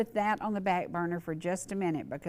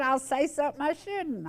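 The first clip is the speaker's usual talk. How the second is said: retold through a laugh, pitched much higher; riled-up friend; 8 semitones higher than usual. Friend warning he'd say something he shouldn't, riled up. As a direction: play him half-laughing his refusal. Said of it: "beyond riled up".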